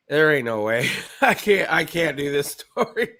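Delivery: loud, high laughing exclamation; laughing disbelief. Retold or imitated. Quoting own reaction, laughing.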